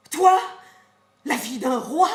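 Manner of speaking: Toi lancé fort, puis la vie d'un roi; la nourrice explose contre la petite; reproche furieux. Acted. (reproche furieux et sarcastique)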